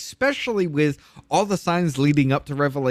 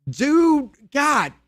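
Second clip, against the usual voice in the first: much higher, louder cry of amazement; awed outburst; 11 semitones higher, 5 dB louder than usual. Real, not acted. Overwhelmed by the verse, crying out to his friends in awe. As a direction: let the awe burst out.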